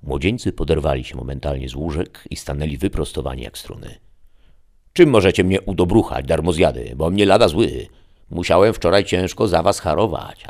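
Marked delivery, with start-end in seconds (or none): 4.9-10.4 loud, higher scolding father; angry father. Acted. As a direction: berate the idle sons.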